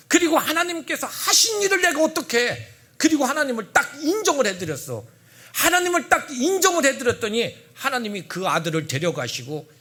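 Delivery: loud, high, laughing proclamation; laughing fervent preaching; joyful preaching. Real, not acted. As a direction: exult over God's doing.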